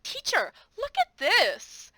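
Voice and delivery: a student eager to show the teacher; excited kid. Retold or imitated. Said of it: "they'll be like".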